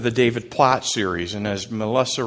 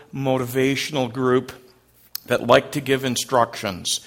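ordinary delivery

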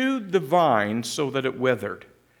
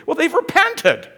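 excited exclamation; 12 semitones higher, 7 dB louder than usual. Acted. Preacher exclaims Nineveh's repentance.

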